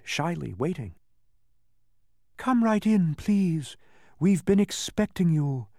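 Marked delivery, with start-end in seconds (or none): 2.4-5.7 high, sweet and lilting welcome, louder; sweet voices in unison. A narrator's reading. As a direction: call out a sweet, singsong greeting. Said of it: "sang out two sweet voices in unison".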